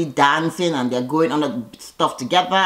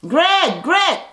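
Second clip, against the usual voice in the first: much higher squeaky mock call; mocking Greg; 11 semitones higher than usual. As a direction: parody the man begging for attention. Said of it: "And Greg's like".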